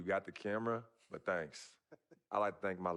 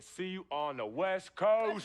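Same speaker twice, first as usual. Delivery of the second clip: slow, loud, higher sign-off; excited shout; 9 semitones higher, 5 dB louder, much slower than usual. Acted. Son signing off his announcement video, shouting. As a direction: bellow the farewell to the camera.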